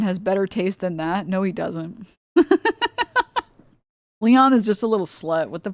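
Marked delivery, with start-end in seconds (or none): 2.3-3.4 sudden high cackle; high squealing laugh; high burst of laughter. Real, not acted.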